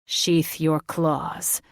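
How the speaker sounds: very slow, steady and level; slow firm command. Acted. Thaddeus orders Catherine to put away claws, slow and firm. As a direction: set each word down firmly.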